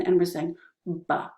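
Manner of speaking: "ba" with lips pressed, no puff of air; "ba" voiced B without a burst of breath; soft B. Shown as a demonstration. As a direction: say "ba" keeping the air in. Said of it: "We're not spitting out the air".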